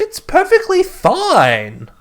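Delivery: high, drawn-out mock exasperation; exasperated outburst. Friend dismissing blood pressure nerves, high and exasperated.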